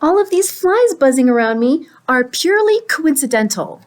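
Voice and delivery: indignant pigeon. Acted. Pigeon explains away the flies, high-pitched indignant denial. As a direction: insist it is nothing to do with you.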